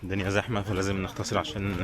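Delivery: usual speaking voice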